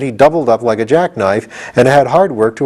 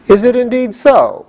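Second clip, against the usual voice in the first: relieved cry; 13 semitones higher than usual. A narrator's reading. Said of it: "cried the Scarecrow".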